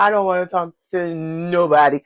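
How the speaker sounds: ordinary delivery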